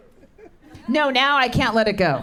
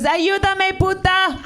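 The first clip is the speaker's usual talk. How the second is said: high call for help; 10 semitones higher, slower than usual. Retold or imitated. Son calling for help.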